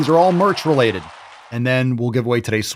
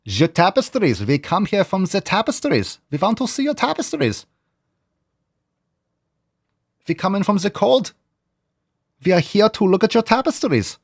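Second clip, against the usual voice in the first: imitating a stuffy Scottish castle guard, pitched up; hammed-up movie quote about tapestries; mock movie accent; 5 semitones higher than usual. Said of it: "soundboard from Indiana Jones three".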